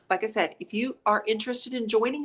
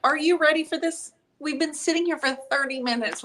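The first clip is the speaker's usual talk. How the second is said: higher-pitched, impatient near-yell; raised voice; 6 semitones higher than usual. Real, not acted. Host chiding partner over the long wait, raised voice. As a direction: pitch it up as if hurrying someone along. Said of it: "Are you yelling at me?".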